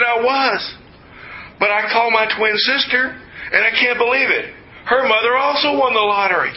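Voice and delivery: high-pitched imitation of an overexcited woman; excited screaming employee. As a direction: play her as beside herself, pitched well up. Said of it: "She's still in there screaming".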